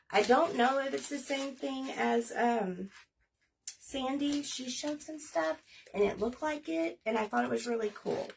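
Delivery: normal talking voice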